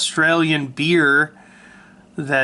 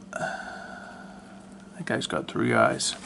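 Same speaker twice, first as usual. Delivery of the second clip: slow breathy whispered aside; 12 semitones lower, 11 dB quieter, much slower than usual. Real, not acted. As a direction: drop to a slow whisper, as a secret joke. Aside about three-eyed drawing.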